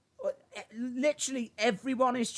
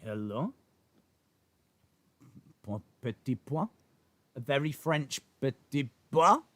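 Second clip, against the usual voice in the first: put-on low, hammy French accent; 6 semitones lower, 4 dB quieter than usual. Streamer answering a viewer's request, mock French accent. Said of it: "It was a French accent".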